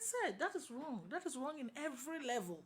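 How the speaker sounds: high-pitched, insistent objection; retold protest. Student challenging her law lecturer, protesting. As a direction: object firmly, pitched well up.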